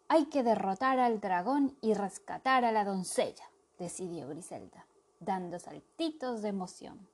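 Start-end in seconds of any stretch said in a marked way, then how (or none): none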